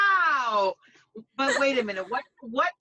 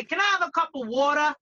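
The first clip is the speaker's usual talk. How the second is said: fast, run-together Bronx "wawtuh"; Bronx accent; faster than usual. Shown as a demonstration. Bronx groomer showing how locals say it, quick Bronx accent. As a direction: toss it off in Bronx style. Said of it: "You say".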